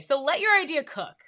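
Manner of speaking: ordinary talk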